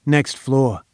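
plain reading voice

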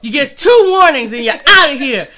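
loud, high, rapid-fire mock scolding; mock angry boss. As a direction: fire the fish in pretend outrage. Playfully firing lazy fish.

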